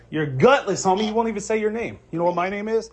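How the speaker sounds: mock his cowardice; scornful taunt. Real, not acted. Insulting the husband.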